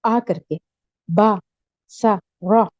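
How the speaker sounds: drawn-out open "आ" on बा, सा, रा; open a sounds. Shown as a demonstration. Teacher to students, sounding out open "आ" vowels. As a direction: sing out each "आ" with the mouth wide. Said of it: "फतहा की आवाज ऊपर जाती है".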